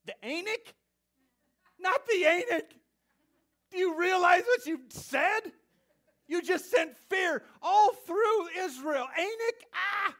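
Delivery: high, loud hammed-up alarm with laughter; exaggerated comic fright; mock panic. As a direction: shriek in mock terror.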